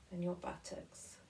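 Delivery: breathy whisper in a body scan. Acted. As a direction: let it fall to a whisper.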